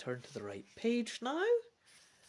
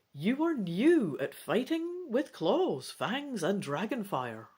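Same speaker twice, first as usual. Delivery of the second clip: steady read-aloud storytelling; narrating a dragon adventure to the family; story narration; 3 semitones higher than usual. A narrator's reading. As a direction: read it like a bedtime adventure book. Long narration passage.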